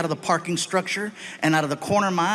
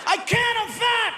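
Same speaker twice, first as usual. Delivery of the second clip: mock whine. Dad protesting to the cop about his hands, high whiny shout. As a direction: whine like a panicked big guy.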